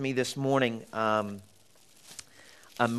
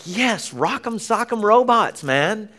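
excited cheer; 8 semitones higher, slower than usual. Retold or imitated. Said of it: "I was like".

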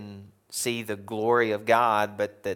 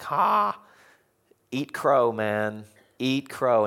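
mock taunt; 3 semitones higher, 4 dB louder, much slower than usual. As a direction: rub it in playfully. Crowd taunting a wrong prediction.

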